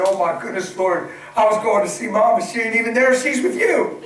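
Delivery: amazed laughing exclamation. Retold or imitated. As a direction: retell it through a laugh. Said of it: "I said".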